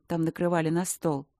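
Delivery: usual speaking voice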